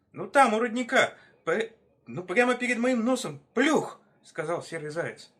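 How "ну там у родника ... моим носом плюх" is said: high, loud, flustered hare; worked-up high-pitched insistence; frantic explaining. Acted. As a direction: blurt it out, stumbling, in alarm. Frightened hare explaining to the others.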